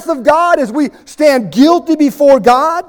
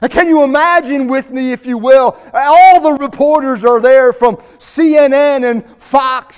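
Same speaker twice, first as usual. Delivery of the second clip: ordinary delivery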